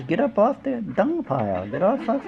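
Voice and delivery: high-pitched, exasperated order; urging scold. Acted. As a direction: rouse them with a mock scolding. Acted scolding answer to complainers.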